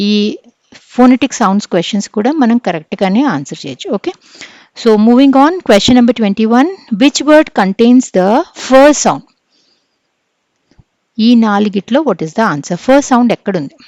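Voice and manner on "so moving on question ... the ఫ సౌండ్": loud, slow reading of the question; quiz reading. Acted. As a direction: announce the question to the class. (తరగతికి ప్రశ్న బిగ్గరగా చదవడం)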